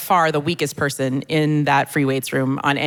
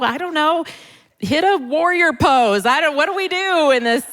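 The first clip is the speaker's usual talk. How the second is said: mock panic; 9 semitones higher than usual. Yoga class panic, acted.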